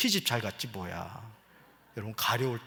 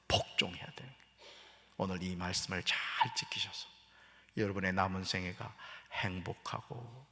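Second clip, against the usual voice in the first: soft whisper into the close; 4 semitones lower, 10 dB quieter than usual. Acted. Pastor closing the sermon to the congregation in a whisper. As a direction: hush it down to a whisper.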